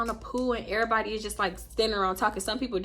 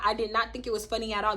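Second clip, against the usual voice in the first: ordinary talk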